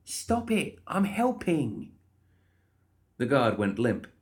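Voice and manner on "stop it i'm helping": very high, exasperated insistence; insistent protest. Acted. Molly fending off the guard's hands, insistent. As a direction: pitch it up, slowing on the end.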